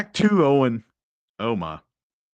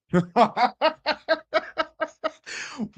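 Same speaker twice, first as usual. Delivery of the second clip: real, much higher burst of laughter; disbelieving high laugh; 13 semitones higher than usual. Real, not acted. Streamer hearing the clip's remark, high incredulous laughter.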